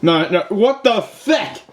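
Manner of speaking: slow, drawn-out cry climbing very high; comic outrage, every word stretched; outraged outburst. Acted. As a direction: build from disbelief to a high yell. Outraged reaction to the scene.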